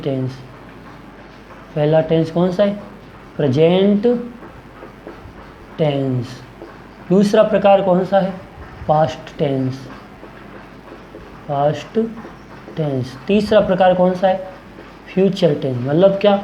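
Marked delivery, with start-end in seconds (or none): 3.4-6.4 word-by-word dictation. Shown as a demonstration. Teacher naming the first type of tense, word-by-word dictation. Repeats term slowly for dictation. 11.5-13.2 past, a long pause, then tense dropping softer; word-by-word dictation. Shown as a demonstration. Teacher naming the second type of tense, word-by-word dictation. Repeats term slowly for dictation.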